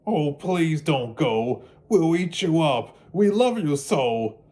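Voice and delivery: wild things begging Max to stay; wild things pleading. Acted. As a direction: beg him not to leave. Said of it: "the wild things cried".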